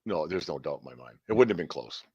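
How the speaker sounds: normal talking voice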